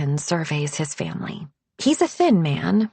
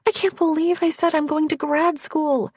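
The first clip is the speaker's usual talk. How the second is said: much higher, anxious and rushed; panicky; 8 semitones higher than usual. Acted. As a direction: spiral into panic. Narrator panicking to boyfriend.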